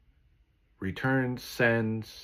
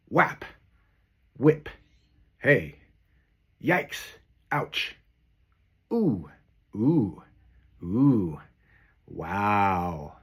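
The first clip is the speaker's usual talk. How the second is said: punchy onomatopoeias; 4 dB louder, much slower than usual. Shown as a demonstration. Recording onomatopoeias for his AI voice model, loud and bouncy. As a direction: pop each sound out like a cartoon. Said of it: "do those onomatopoeias".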